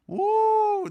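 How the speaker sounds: high, loud whooping cheer; a sudden whoop of hype; excited whoop. Real, not acted. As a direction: let out a big cheer to fire up the room. Hyping up the audience.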